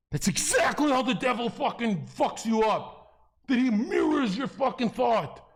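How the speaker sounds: high, loud, emphatic rant; real heated outburst; impassioned yelling. Real, not acted. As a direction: drive the point home at a shout. Heated argument about the devil.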